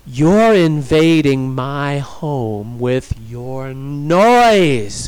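slow, loud, drawn-out accusation; emphatic, deliberate shout; yelling. A narrator's reading. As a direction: yell it with long vowels, swelling on noise. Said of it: "she yelled at the vampires".

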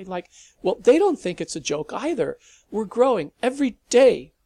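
put-on teen rushing his explanation as Malcolm grows impatient; sped up, nervous. A narrator's reading. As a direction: hurry through it before he loses you. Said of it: "saw patience wearing thin and sped up".

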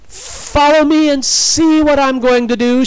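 high, loud rallying shout; full-voiced, excited battle cry. A narrator's reading. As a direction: cry it out defiantly. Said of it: "she cried".

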